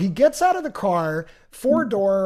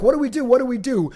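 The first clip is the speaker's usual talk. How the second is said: higher, louder panic repeated; panicked; 5 semitones higher, 4 dB louder, faster than usual. Retold or imitated. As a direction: plead for a plan, alarmed. Panicking over lost friend.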